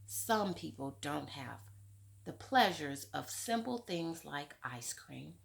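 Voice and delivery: usual speaking voice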